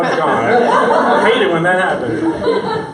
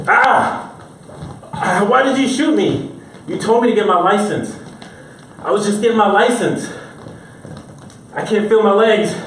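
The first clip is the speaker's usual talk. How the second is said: mock pained victim; 5 semitones higher than usual. Acting a shot man.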